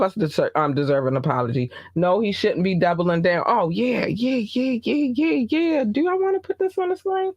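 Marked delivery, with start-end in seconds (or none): 3.5-7.3 high teasing sing-song; mocking inner voice. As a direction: play a tempted version of yourself. Mock inner voice deliberating.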